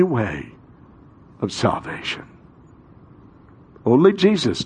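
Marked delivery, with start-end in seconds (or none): 0.0-2.3 unvoiced trailing whisper; hushed close. Real, not acted. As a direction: breathe it out. Soft fall after emphatic line.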